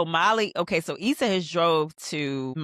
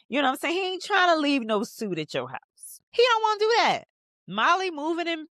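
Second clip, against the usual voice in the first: high, sassy and knowing, calling him out; 10 semitones higher than usual. Real, not acted. Viewer warning Molly about her man, high and sassy.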